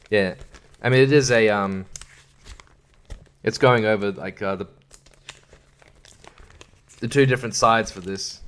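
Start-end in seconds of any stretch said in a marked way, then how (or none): none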